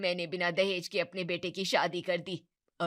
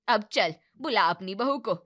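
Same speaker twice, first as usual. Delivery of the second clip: ordinary talk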